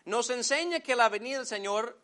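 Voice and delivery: usual speaking voice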